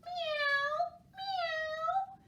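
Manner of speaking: very high meowing; high mewing cat. A narrator's reading. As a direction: meow like a kitten. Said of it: "said Cat".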